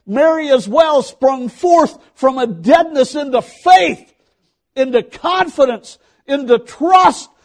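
loud, rising sermon climax; preaching. Acted. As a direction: preach it with full fervour.

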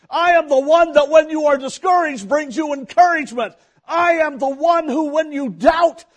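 high, loud, building proclamation as God; full-force preaching. Acted. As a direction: shout each declaration like a revival preacher.

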